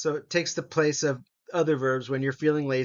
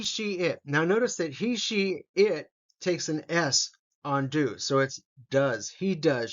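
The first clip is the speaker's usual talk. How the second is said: normal talking voice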